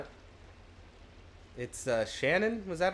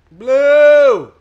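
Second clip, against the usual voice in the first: excited calling; 13 semitones higher, 21 dB louder, much slower than usual. Acted.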